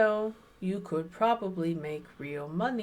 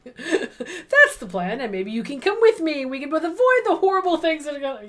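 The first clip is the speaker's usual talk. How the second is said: laughing; 6 semitones higher, 8 dB louder, faster than usual. Acted. Reads Stella's line laughing.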